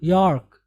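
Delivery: यॉर्क with a full rolled r; यॉर्क with a firm r. Shown as a demonstration. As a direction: roll the r in यॉर्क. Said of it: "थोड़ा सा पुर".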